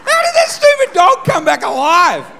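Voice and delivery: shrieking squeak of disbelief, then a loud outraged yell; high, loud mock outrage at the revived dog; shocked. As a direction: leap to a shriek at the dead dog breathing.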